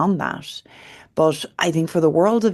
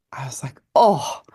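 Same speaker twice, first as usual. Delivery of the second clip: choked up. Real, not acted. Moved retelling daughter's plea.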